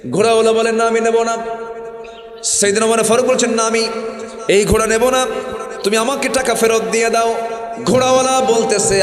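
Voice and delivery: high, loud preaching cry; preaching at full force. Acted. Preacher crying out to the congregation in a loud sermon. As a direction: rise up and preach it hard.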